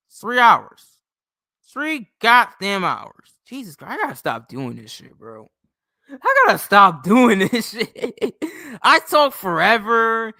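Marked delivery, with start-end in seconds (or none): 0.1-3.3 exasperated outburst. Real, not acted. Exasperated at streaming three hours. 6.1-10.3 louder, slow, breaking into a laugh; half-laughing vow to stop rambling; laughing at himself. Real, not acted.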